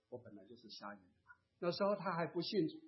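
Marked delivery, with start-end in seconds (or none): 0.1-1.3 very soft, low admission; hushed, low-pitched, resigned reply; meek reply. Retold or imitated. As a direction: answer meekly, almost under the breath. Blind man's meek reply, retold.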